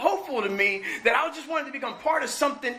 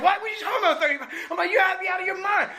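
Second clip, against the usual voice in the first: high, fast mock indignation, pitched well up; replaying how he blew up at staff, half-joking; retold outrage; 8 semitones higher, faster than usual. Recovering addict told of a thirty-five-day blackout, mock outraged protest. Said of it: "I'm cursing them out".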